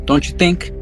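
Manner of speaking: ordinary talk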